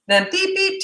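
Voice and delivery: high mock timer beeps for a parent's routine; imitating a timer beep. Parent mimics the timer ending screen time, high mock beeps. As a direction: beep it out like a kitchen timer going off.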